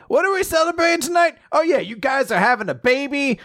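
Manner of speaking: put-on high, sloppy drunk old men; drunk old guys. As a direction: slur it up like tipsy old men. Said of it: "two old drunk guys are just like".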